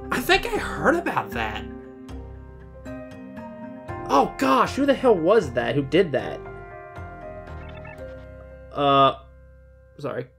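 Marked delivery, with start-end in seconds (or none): none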